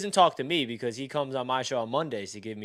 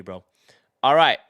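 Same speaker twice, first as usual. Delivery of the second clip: ordinary delivery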